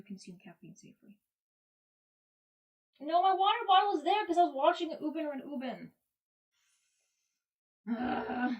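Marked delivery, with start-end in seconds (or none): 3.0-8.5 much louder and higher, blurted. Real, not acted. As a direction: blurt it out loud. Sudden loud outburst.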